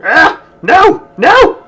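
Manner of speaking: high, loud panicked yelps; real alarm, cry out. Real, not acted. Something goes wrong in game.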